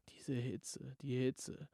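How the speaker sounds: breathless whisper about the heat; feverish whisper. Acted.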